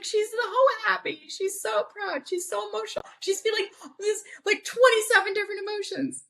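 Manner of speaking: very high, gushing mom imitation; emotional mom. Proud mom explains daughter's emotions to dad, high emotional gush. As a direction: squeal it like an overwhelmed proud mom.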